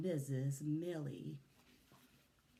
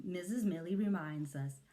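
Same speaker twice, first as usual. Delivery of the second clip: ordinary delivery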